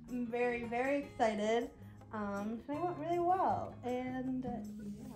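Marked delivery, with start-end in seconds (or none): none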